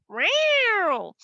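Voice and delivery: loud high meow; cat meow. Acted. The cat threatening the mice, loud high meow. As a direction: meow like a big scary cat. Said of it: "And the cat went".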